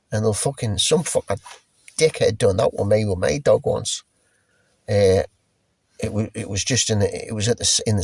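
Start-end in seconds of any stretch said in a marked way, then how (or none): none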